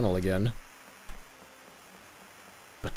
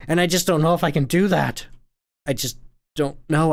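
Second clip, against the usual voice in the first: despairing; 7 semitones higher, 8 dB louder than usual. Acted. Character's despair.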